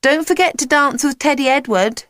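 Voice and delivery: high-pitched teasing call. A narrator's reading. Jack teasing his teacher as he leaves, calling out. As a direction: call it back cheekily. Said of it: "Jack called out".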